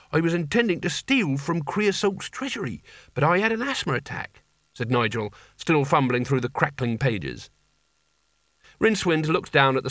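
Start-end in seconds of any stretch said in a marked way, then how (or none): 0.1-4.4 higher, lightly voiced eager lad; earnest boy hero. Young would-be barbarian explains his failed theft, earnest and higher. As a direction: play the keen young barbarian.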